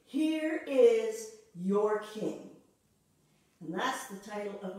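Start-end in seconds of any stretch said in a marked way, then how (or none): 0.0-2.5 loud, very slow announcement; Pilate's proclamation. Retold or imitated. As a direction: proclaim it slowly to the crowd, leaning on here. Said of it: "He just says to them".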